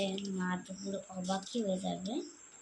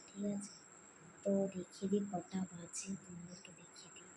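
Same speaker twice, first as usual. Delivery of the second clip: hushed; 6 dB quieter than usual. Real, not acted. Vlogger keeping quiet so mum won't wake, hushed. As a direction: keep it down, the house is asleep. Family asleep, late night.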